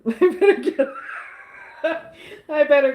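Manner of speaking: giggling helplessly mid-line; laughing. Real, not acted. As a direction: try to speak but laugh takes over. Laughing while trying to leave.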